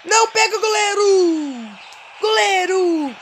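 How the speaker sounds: high, loud drawn-out yell; yelling at the screen. Real, not acted. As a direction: shout for the keeper to save it. Yelling at the game's goalkeeper.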